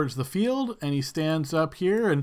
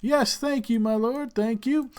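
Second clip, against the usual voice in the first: high, courteous host voice; deferential host; 7 semitones higher than usual. Acted. Host on the field thanking Michael, high deferential voice. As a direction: thank a lord before a crowd. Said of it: "the guy that's out on the field says".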